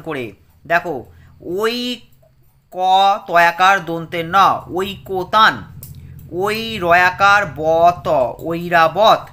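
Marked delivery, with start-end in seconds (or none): 1.6-9.2 spelling drill. Shown as a demonstration. Teacher spells words for children.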